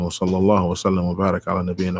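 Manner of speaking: rapid, even Arabic closing formula; fast, slightly low recited blessing; closing Arabic doxology. Real, not acted. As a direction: run swiftly through the closing praise.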